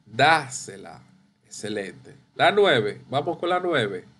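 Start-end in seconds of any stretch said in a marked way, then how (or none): 0.1-1.0 Dásela leaning on the first syllable DÁ; stress on DÁ. Shown as a demonstration. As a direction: stress DÁ, drop low on sela. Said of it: "Ahí lleva la tilde".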